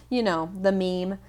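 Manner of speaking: ordinary talk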